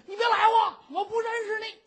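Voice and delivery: put-on high, loud girl's shriek; frightened girl shouting at a stranger; girl screaming. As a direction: scream to push him off. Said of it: "姑娘站起来喊".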